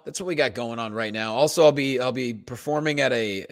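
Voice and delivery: normal talking voice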